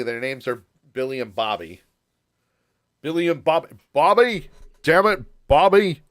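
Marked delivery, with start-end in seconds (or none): 3.0-6.0 louder exasperated cartoon dad yelling at his son; a hint of a fed-up Texan father; Hank Hill impression. Host mimics a cartoon father scolding Bobby, mildly louder. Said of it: "you ain't King of the Hill".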